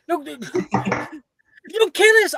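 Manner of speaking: high frantic pleading; panicked. Acted. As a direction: beg them in a panic. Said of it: "Billy, like, grabs onto your wand".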